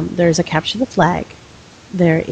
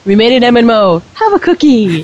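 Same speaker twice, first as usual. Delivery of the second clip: high, mock-proud congratulation; sarcastic; 6 semitones higher, 6 dB louder than usual. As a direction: pat them on the head sarcastically. Mock praise for the award.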